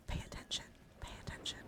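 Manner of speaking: soft, breath-only whisper; whispered coaxing. Pretend. Streamer telling the camera off, whispered. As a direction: whisper it as a gentle little order.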